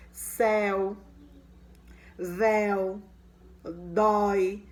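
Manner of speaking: like a teacher reading a list to the class; example words said out loud. Shown as a demonstration. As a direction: present each word clearly. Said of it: "Aí eu tenho uns exemplos".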